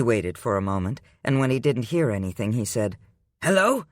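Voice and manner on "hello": raised questioning hello; calling hello. A narrator's reading. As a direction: call into the dead line. Calls into silent phone.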